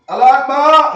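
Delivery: high, loud excited shout; sudden loud outburst, then regret; loud blurt. Real, not acted. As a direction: blurt it out before you can stop yourself.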